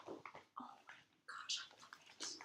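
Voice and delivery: hushed and breathless; whispered delight. Real, not acted. To listeners, holding the brioche, whispered delight. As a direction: whisper your love for it.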